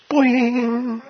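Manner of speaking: high, sustained springy boing; boing sung out on a high note; cartoon boing. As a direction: imitate a spring popping into place. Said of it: "the eye goes".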